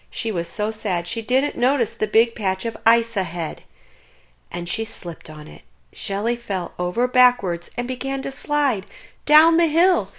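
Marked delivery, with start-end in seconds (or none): none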